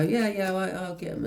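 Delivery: high, put-on voice of whoever opens the door; person answering door.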